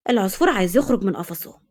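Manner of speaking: ordinary delivery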